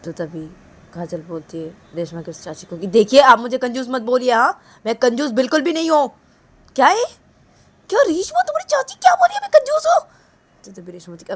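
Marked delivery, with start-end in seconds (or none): 2.9-10.1 loud offended denial rising to a high screech; acted tantrum, climbing very high; angry protest. Kajal objecting to Reshma's aunt's insult, angry. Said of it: "गुस्सा मत करो ना".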